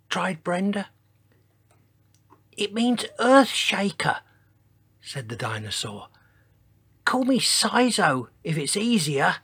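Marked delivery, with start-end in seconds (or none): 2.6-4.2 high little dinosaur voice; tiny voice. A narrator's reading. Dinosaur explains his name to iguanodon, tiny-voiced. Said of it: "in a voice so tiny". 7.0-9.4 high little dinosaur voice; tiny voice. A narrator's reading. Dinosaur explains his name to iguanodon, tiny-voiced. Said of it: "in a voice so tiny".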